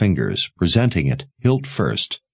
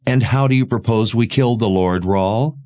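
slightly raised, challenging question; a touch of heat, pitched up; mildly heated; 4 semitones higher than usual. A narrator's reading. Mother challenging Sebastian's plan to kill Rahl, mildly heated. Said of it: "Her mother's voice heated".

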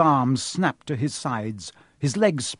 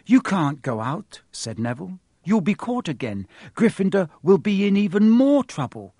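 anxious pleading; 7 semitones higher, 5 dB louder than usual. Acted. Neville pleading.

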